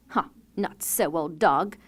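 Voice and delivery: scornful cry. A narrator's reading. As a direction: brush the dog off with contempt. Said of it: "the stepmother cried".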